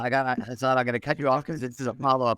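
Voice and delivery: normal talking voice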